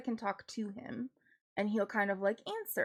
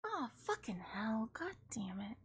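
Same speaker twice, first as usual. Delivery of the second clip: whispered swearing under the breath; whispered curse; 11 dB quieter than usual. Real, not acted. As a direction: hiss it so nobody hears.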